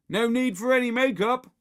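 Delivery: high, loud taunting call; shout over the wall. A narrator's reading. Henry heckles Margaret next door, shouting. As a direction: call the jibe across a garden wall. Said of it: "shouted Henry from over the wall".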